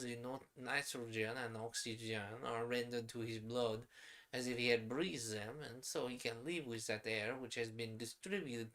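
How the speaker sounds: put-on measured, careful French-accented English. Professor Masson explaining to bystanders, in a French accent. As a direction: speak it like a French chemist, in a French accent. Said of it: "my terrible French accent".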